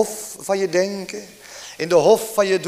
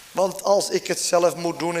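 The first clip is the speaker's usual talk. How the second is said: ordinary talk, nothing marked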